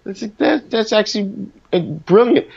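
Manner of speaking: high, rising delight growing on each yeah; hearing your own record fresh and loving it; pleasantly surprised. Retold or imitated. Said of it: "I listen to it and I go".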